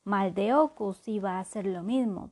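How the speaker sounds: ordinary delivery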